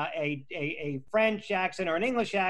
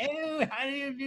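put-on high, silly English accent; mock upper-class English accent; mock posh English; 9 semitones higher, 4 dB quieter than usual. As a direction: ham up a posh Brit. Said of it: "all those silly expressions".

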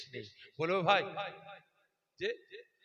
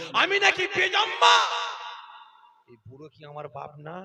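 threatening rage; 7 semitones higher, 13 dB louder than usual. Acted. Son threatening his weeping mother with a sword, rage.

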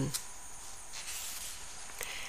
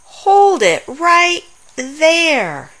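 louder, higher, very slow order; stern command; 6 semitones higher, 5 dB louder, much slower than usual. Acted. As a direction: halt them in their tracks. Catching someone about to scratch.

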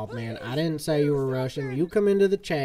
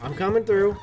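calling out; 5 semitones higher, 6 dB louder than usual. Real, not acted. Riding through crowd, calling out.

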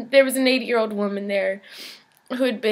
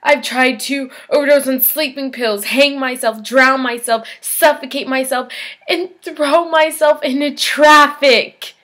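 louder with each item, building; mounting frustration; 4 semitones higher, 6 dB louder than usual. Acted. Patient lists failed suicide attempts, with mounting frustration. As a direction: drive the list harder to its end.

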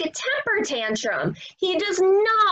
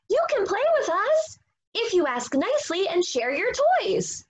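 high, quick little pig voice; chirpy piggy, higher and faster; 8 semitones higher, faster than usual. Acted.

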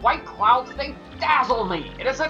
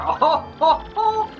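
haughty laugh; 10 semitones higher, 4 dB louder than usual. Acted. Fop's boastful laugh.

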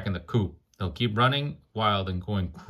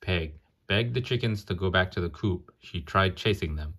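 ordinary delivery

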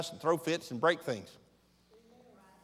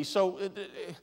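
ordinary talk

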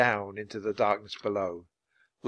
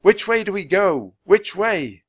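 high, loud, insistent question; urgent raised voice, falling at each end; calling out; 8 semitones higher, 7 dB louder than usual. A narrator's reading. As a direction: call up to someone far away. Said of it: "Jack called out".

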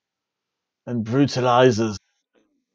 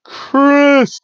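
high, loud booming ring announcer; 8 semitones higher, 4 dB louder, slower than usual. Pretend. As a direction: stretch out the name like a ring intro. Ring announcer introduces champion.

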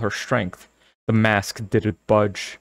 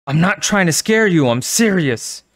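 crying out; 7 semitones higher, 4 dB louder than usual. Acted. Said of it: "Carly Beth cried".

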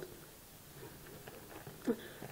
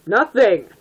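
loud, higher burst; loud emphatic denial; insistent denial; 3 semitones higher, 8 dB louder than usual. Acted. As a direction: shut down the question loudly.

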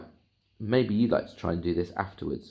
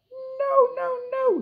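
gingerbread man voice; much slower than usual. Acted. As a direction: squeal a refusal. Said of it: "said the gingerbread man".

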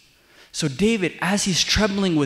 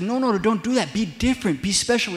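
higher-pitched, insistent urging; urgent pleading; 7 semitones higher, 4 dB louder than usual. Acted. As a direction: plead with them emphatically. God urging reluctant people.

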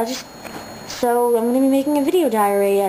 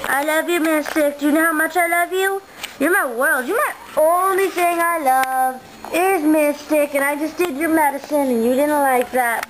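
higher, doting pet talk; 5 semitones higher than usual. Real, not acted. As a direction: dote on the dog. Cooing to her pet Mystic.

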